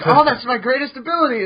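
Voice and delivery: mock boast.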